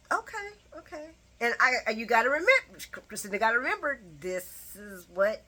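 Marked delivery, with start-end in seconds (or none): 0.1-1.1 awkward okay. Retold or imitated. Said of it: "Christina's face was like".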